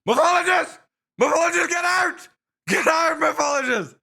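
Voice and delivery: high, loud pretend screams of the name; mock screaming fan. Streamer playing a fan screaming him out of the show, high mock yell. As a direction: shriek him out of the room like a crazed fan. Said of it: "There's gonna be a lot of screaming".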